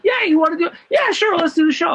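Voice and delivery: high-pitched, eager yes; carefree acted-out enthusiasm; breezy eagerness. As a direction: agree on the spot, blithely enthusiastic. Breezily agreeing, unaware of star.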